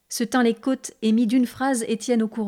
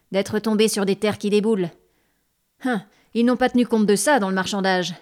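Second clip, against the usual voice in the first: ordinary delivery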